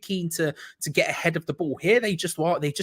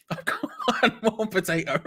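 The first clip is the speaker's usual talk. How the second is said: high, laughing repeat; helpless giggly voice; cracking up; 4 semitones higher than usual. Real, not acted. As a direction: break into laughter through the words. Same words, cracking up laughing.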